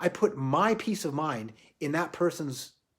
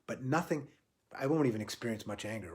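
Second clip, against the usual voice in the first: usual speaking voice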